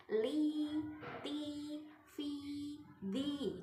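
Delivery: each syllable held out on the i; long i vowels. Shown as a demonstration. Teacher showing ya after kasrah, drawn-out syllables. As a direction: stretch the ya sukun.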